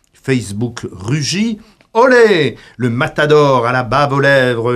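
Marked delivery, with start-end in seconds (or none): 1.9-2.6 high, loud joyous olé; hammed-up corrida shout; mock bullring cheer. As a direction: cheer like a whole arena. Bullfight crowd cheer, mocked.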